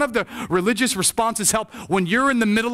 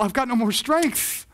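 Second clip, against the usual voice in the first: high, slow plea of exhaustion; spent despair; 4 semitones higher than usual. Acted. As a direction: act out someone at the end of their rope. Voicing relative of suicidal sibling.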